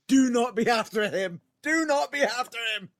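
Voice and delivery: loud, high mock pleading; emphatic self-talk. Wrestler thinking before following Kelsey, loud mock dread. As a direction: plead with yourself, punching it out high. Acts out his dreading thought.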